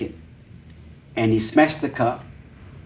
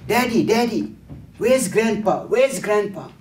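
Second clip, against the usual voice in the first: put-on small child calling out higher, anxious and searching; little boy; 7 semitones higher than usual. The little boy asks his father where Grandpa is, in a high child's voice. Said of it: "And the son, the little boy, asked the father".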